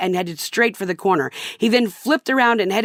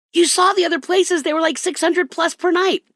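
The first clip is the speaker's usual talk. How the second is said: whiny husband; 10 semitones higher than usual. Retold or imitated. Husband protests to wife about the late hour, high and whiny. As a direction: whine like a put-upon man.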